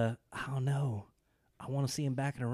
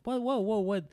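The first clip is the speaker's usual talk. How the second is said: high, startled whoa whoa what; a lightly acted double take; mock surprise; 8 semitones higher than usual. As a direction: play it like the rug was just pulled out. His reaction to the sudden finals.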